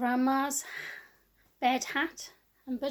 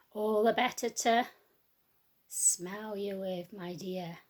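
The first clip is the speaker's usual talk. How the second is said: sly wolf; 3 semitones lower, slower than usual. Acted. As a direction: draw it out low and menacing. Wolf's menacing reply.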